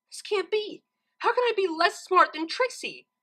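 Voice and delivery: high, anguished wail; crying out. A narrator's reading. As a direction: Twilight can't believe she lost. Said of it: "she cried".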